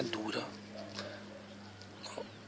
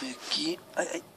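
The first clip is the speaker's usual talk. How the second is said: whisper; 3 semitones higher, 6 dB quieter than usual. Real, not acted. Calling quietly near the frost.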